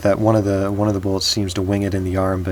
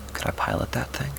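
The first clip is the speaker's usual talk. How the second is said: breathless higher whisper, barely voiced; whispered thought; 5 semitones higher, 7 dB quieter than usual. Max whispers his risky plan to himself, hushed. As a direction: hush it like a secret plan to yourself. Max thinking about the walker.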